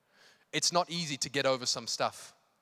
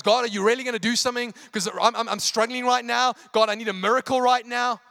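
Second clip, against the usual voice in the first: loud, high, hurried pleading; mock-panicked prayer for help; freaking out; 8 semitones higher, 9 dB louder than usual. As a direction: plead with God in acted panic. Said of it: "who's also freaking out inside".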